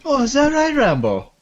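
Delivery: very high, loud hammy Irish voice; Irish accent. Acted. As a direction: squeal it in a mock Irish lilt. Mocking Irish voice banter.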